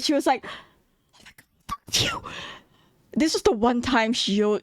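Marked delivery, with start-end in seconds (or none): 1.1-2.2 very high, hushed squeal; excited friend. Retold or imitated. Friend reacting to him talking to her, excited and squeaky. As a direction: imitate a friend's thrilled squeal, kept small. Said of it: "my friend was so excited".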